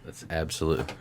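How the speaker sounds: normal talking voice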